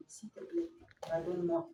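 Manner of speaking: aigu et doux, une enfant qui implore; petite voix suppliante. Acted. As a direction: supplie maman tout bas d'une voix de fillette. (voix d'enfant aiguë et douce)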